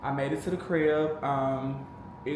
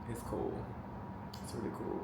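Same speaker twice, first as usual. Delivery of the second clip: breathy whispered words; near whisper; 3 semitones lower, 13 dB quieter than usual. Real, not acted. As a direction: let it fall to a whisper. Emotional reflection on moving.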